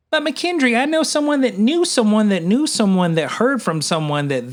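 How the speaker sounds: high, whiny imitation of an anti-vaxxer; mock objector. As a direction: mimic a gullible skeptic pleading. Voices an imagined anti-vaxxer objector.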